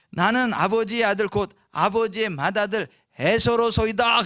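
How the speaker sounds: loud, slow solemn declaration; Esau declaring. Retold or imitated. Esau announces himself to blind Isaac, loud and slow. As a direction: announce yourself as the firstborn. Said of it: "그랬어요".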